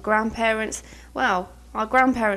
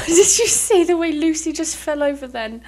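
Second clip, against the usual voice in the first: laughing; 6 semitones higher, 6 dB louder than usual. Real, not acted. Laughing at sim falling.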